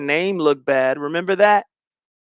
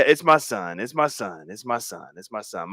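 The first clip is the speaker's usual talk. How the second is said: fading repetition; 7 semitones lower, 6 dB quieter than usual. Acted. Repeating a phrase, fading out.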